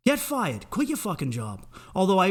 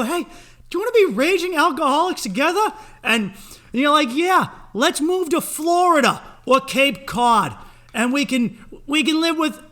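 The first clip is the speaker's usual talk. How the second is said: high and loud, slowing into the plan; giddy excitement; 10 semitones higher, 7 dB louder than usual. Acted. Two divorced drinkers planning a move, high, loud and giddy. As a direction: gush like a new best friend.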